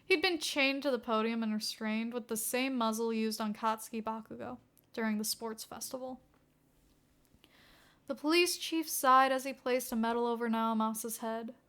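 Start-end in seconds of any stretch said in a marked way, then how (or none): none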